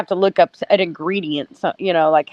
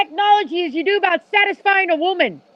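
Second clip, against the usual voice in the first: mocking insult; 8 semitones higher than usual. Insulting Mark mid-argument.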